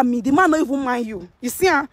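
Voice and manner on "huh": ordinary talk, nothing marked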